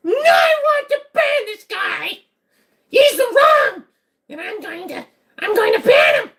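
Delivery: very high, slow, crying shout; mod voice in my head. Pretend. Streamer imitates how his mods sound in his head, very high crying shout. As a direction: whine like a power-hungry mod. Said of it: "Every single one of my mods sounds like that in my head".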